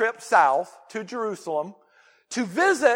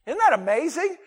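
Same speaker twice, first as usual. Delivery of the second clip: ordinary talk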